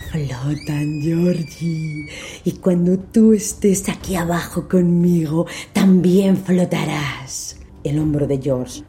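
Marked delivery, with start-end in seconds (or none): 0.1-7.6 slow, gloating monster clown; menacing clown voice. Acted. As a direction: gloat as you drag him down. Clown drags George down.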